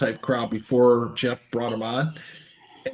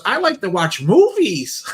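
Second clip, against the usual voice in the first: a higher, hammed-up guest voice; funny voice; 5 semitones higher than usual. Retelling a stream guest's answer in a mock voice. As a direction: mimic the odd guest's answer, pitched up. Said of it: "The voice was funny".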